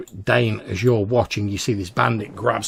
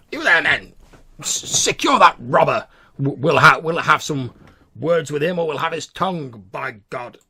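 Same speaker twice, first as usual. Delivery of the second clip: loud, higher blustering command; merchant shouts; 6 semitones higher, 8 dB louder than usual. Acted. Merchant shouting at soldiers to seize the robber. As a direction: bellow orders at the guards. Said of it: "the merchant shouts".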